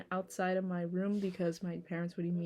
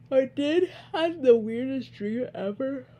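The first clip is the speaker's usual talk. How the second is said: loud and higher, yawning it out; 6 semitones higher, 9 dB louder than usual. Real, not acted. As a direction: let a yawn stretch the words. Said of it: "I could just stop fucking yawning".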